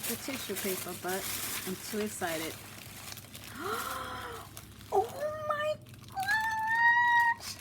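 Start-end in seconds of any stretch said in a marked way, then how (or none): 2.6-4.9 a long, very high gasp of delight; huge delighted gasp. Real, not acted. Opening a gift, overjoyed. 4.9-7.6 very high, loud shriek of joy; excited squeal. Real, not acted. Opening a gift, overjoyed.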